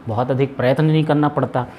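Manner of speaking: normal talking voice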